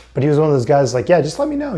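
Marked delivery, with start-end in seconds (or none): 1.1-1.8 high, breezy fake-nice voice; insincere manager. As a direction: brush it off like a manager who doesn't mean it. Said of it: "He's saying it 'cause that's what he has to say".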